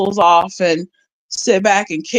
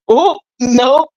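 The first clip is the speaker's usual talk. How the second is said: retold dread; 5 semitones higher, 4 dB louder, much slower than usual. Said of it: "I was like".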